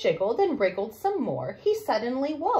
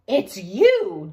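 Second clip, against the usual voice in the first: relieved surprise; 4 semitones lower, 7 dB louder than usual. Acted. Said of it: "Daddy cried".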